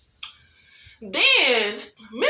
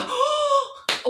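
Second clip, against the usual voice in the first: big, high pretend gasp; mock shocked gasp; 14 semitones higher than usual. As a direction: gasp like a scandalized viewer. Mimics expected viewer shock while watching.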